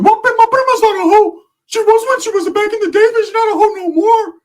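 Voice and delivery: very high, loud cartoonish protest; Ricky. Cousin defending his prima, loud squeaky put-on voice. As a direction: shriek it as an exaggerated indignant impression. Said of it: "Ricky's like".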